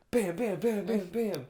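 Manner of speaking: rapid-fire bams. Retold or imitated. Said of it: "but I'm like".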